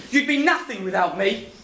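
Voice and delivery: normal talking voice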